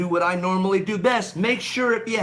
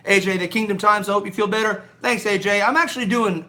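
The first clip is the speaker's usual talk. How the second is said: usual speaking voice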